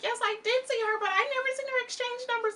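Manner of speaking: high put-on voice of a timid witness; mimicking Secrets. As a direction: squeak it out like a nervous witness. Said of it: "Secrets comes out very much like".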